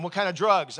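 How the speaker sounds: officer questioning the pastor about drugs, loud and blunt; loud questioning cop. Retold or imitated. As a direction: bark the question like a suspicious officer.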